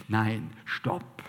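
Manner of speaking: ordinary delivery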